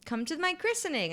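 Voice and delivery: very high mock friend voice; inviting friend. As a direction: pipe up high, imitating an eager invitation.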